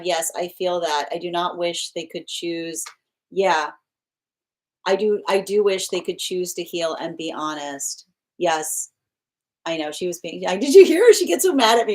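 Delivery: normal talking voice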